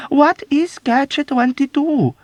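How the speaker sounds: high, loud, slow cry of a question; desperate demand. Acted. Yossarian desperately demanding what Catch-22 means. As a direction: plead and demand at once.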